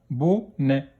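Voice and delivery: "Bonnet" split into bo, nè; a gap inside "Bonnet"; syllable by syllable. Shown as a demonstration. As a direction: sound out "Bonnet" in two beats. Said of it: "dans quelle syllabe".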